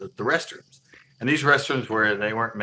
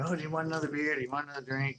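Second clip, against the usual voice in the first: higher, softly voiced mimicry of a girl offering drinks; pitched-up impression of a friend offering; imitating eager hostess; 6 semitones higher, 5 dB quieter, faster than usual. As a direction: lightly mimic her offer in a raised voice.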